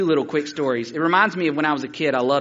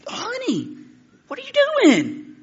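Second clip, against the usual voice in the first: very high, shrieky mock scolding; aghast grandmother; 4 dB louder, slower than usual. Grandmother catching boy flicking cocoons, aghast scolding. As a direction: imitate a horrified granny, pitch way up.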